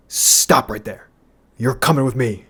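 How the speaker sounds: imitated menacing store employee; malicious command. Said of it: "pure hatred and malice".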